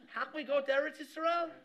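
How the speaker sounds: high, loud, incredulous question; mock protest. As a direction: act out an indignant objection. Acted wife objects to leaving inn.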